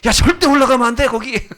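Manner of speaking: high, loud mock scolding; frantic mothers. Mothers forbidding their children the pulpit, frantic mock yell. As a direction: imitate an alarmed mother yelling at her child. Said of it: "엄마들이 난리가 났어요".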